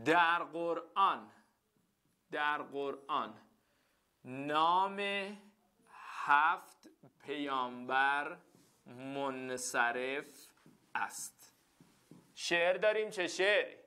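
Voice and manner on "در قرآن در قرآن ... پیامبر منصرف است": very slow, halting dictation; teacher dictating a rhyme rule. Shown as a demonstration. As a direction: dictate the line word by word.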